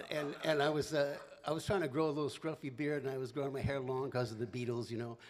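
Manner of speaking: ordinary talk, nothing marked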